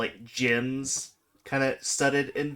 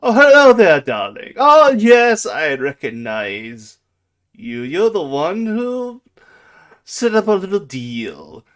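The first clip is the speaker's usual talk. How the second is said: loud, high, swooping theatrical greeting; grand effusive dragon; 7 semitones higher, 14 dB louder than usual. Acted. Dragon greets the one who made a deal, effusive.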